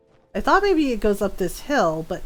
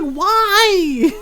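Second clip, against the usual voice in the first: high, louder drawn-out imitation; mock wailing protest; 7 semitones higher, 5 dB louder than usual. Kid jumped at by dad, mock wailing protest. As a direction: wail it out as the pestered kid.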